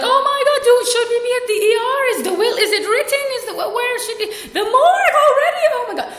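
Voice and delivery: high-pitched, loud hysterical overreaction; mock panic. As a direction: shriek it in hammed-up alarm, spiralling. Acts out panic at bad news.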